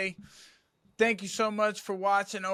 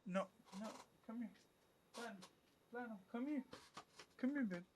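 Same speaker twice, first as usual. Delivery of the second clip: soft, higher coaxing voice for a pet; talking to a dog; 5 semitones higher, 13 dB quieter, much faster than usual. Real, not acted. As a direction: coax the dog over gently. Coaxing his dog to come.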